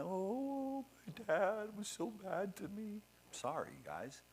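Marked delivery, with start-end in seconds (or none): 0.0-3.0 high, whiny mock sob, hammed up; mock crying. Mocking people who cry about their dad, in a hammed-up high whine. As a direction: parody a self-pitying crybaby. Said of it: "I'm not up here crying".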